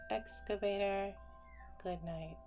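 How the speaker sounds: ordinary delivery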